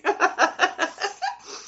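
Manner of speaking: high real laugh at the memory; a rising giggle. Real, not acted. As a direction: laugh at the thought of those eyes. Laughs about scary big eyes.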